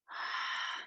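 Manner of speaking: raspy throat exhale, lips sealed; ocean throat breathing. Shown as a demonstration. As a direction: breathe out like waves on sand. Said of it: "So a deep breath in".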